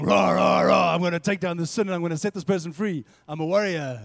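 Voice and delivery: loud, fired-up war cry; hammed-up triumphant battle shout; warrior battle cry. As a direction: roar like the spirit charging in. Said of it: "the spirit that's inside of us is going".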